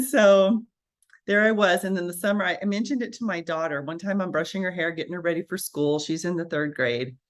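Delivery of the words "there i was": ordinary talk, nothing marked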